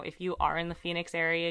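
normal talking voice

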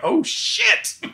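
very high, rising alarmed cry; alarmed oh shit. Retold or imitated. Hearing gunshots under the crate, a high alarmed cry. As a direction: gasp it out as shots pop.

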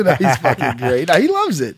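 ordinary delivery

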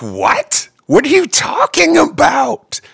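high, loud, drawn-out disbelief; incredulous outburst. Retold or imitated. Singer replying to a complaining fan, incredulous high yell. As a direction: exclaim it in mock outrage, pitch shooting up. Acting out his baffled reply.

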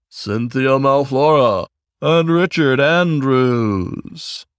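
slow, drawn-out nominee reading; high emcee building suspense; announcer voice. Acted. Host reading nominees slowly.

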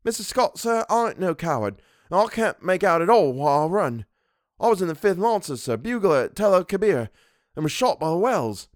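high-pitched, protesting Cockney soldier; Cockney dialect. A narrator's reading. Former bugler insisting he is no coward, in Cockney dialect. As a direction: defend yourself as a proud Cockney. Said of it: "Thomas's Cockney dialect".

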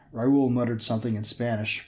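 plain reading voice

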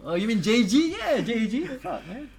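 excited. Real, not acted. Mock joke about nickname.

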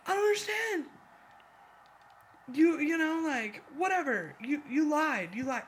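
high, whining, accusing; mock her upset whine; whiny Camilla impression. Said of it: "Everything is always like".

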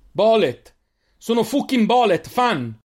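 high, loud cry over explosions; panicked shout under attack; alarmed shout. A narrator's reading. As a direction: yell a warning as bombs land.

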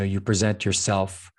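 ordinary talk, nothing marked

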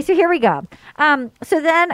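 normal talking voice

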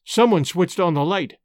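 ordinary delivery

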